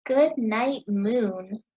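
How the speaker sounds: normal talking voice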